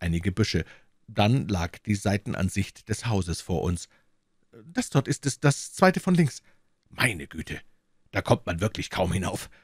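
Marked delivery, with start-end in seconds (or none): 4.6-6.4 put-on high, anxious young secretary; worried assistant. A narrator's reading. Bennet anxiously telling Holmes about the professor, high. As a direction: confide the fear.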